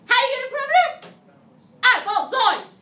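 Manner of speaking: high, loud sing-song call; weird voice. Shown as a demonstration. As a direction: shout the question back at them. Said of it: "We say it in a weird voice".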